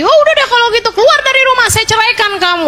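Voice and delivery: mock husband yelling for divorce; preacher voicing an enraged husband shouting; angry husband. Husband angrily voiced in story.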